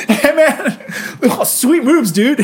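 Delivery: high, laughing stoned dude voice; goofy burnout voice; stoned party guy. Retold or imitated. Mocking stoned dancers.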